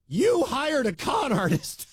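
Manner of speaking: hammed-up gotcha question, drawn out; mock accusation. Host mimicking columnist's jab, mock accusing.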